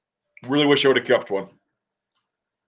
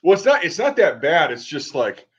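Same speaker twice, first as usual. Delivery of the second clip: usual speaking voice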